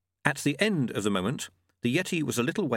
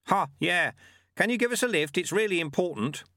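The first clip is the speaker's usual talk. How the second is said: put-on higher, lively old monk; small monk voice; 7 semitones higher than usual. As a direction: call out like a spry little monk. Lutze asks Yeti.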